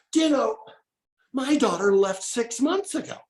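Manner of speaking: a hint of a startled older woman, fading at the end; astonished older lady. Lady's sudden realization voiced.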